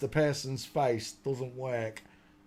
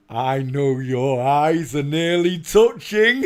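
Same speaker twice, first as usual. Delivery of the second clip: lightly put-on Geordie accent, slow and louder, laughing; 8 dB louder, slower than usual. Retelling Mickey's line to Dell in a Geordie accent. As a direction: drawl it out in a mock Geordie accent, chuckling. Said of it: "all you hear is a Geordie accent going".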